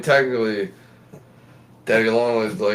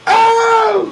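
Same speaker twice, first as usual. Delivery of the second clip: loud high scream; mock scream; 7 dB louder than usual. Retelling parents screaming at a wolf spider, loud and high. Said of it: "they're like".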